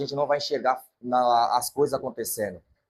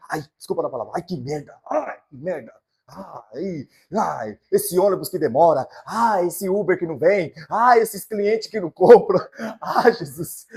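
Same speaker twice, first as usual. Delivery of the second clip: mock grumbling complaint; 3 semitones higher, 6 dB louder than usual. Acting a grumbling believer.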